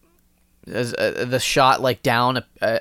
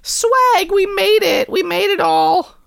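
high silly voice; 3 dB louder than usual. Pretend. Mock bragging in high voice.

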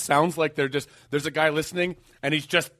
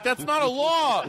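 high, drawn-out objection; mock protest; 10 semitones higher, slower than usual. Litigant answering the TV judge, mock indignation. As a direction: object slowly, pitched way up.

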